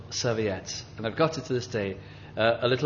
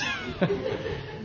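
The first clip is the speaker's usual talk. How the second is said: breathy laugh; 5 dB quieter than usual. Real, not acted. Laughs at his own story.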